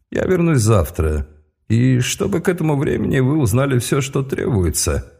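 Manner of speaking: calm menace on the way out; icy voice. Acted. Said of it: "ледяным голосом".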